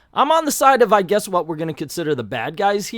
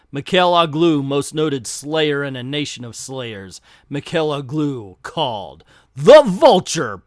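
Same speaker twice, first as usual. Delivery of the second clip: dramatic narration; 4 dB louder than usual. Acted. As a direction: build ominous dread around the name. Dramatic reading of villain intro.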